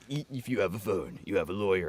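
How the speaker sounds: low, deep commercial announcer; TV ad voiceover. Retold or imitated. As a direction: read it like a lawyer ad. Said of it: "like that old Science and Kirk fucking commercial".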